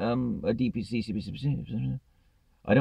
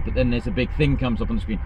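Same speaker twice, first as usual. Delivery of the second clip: ordinary talk, nothing marked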